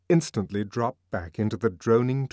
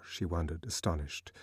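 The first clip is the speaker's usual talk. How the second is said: ordinary delivery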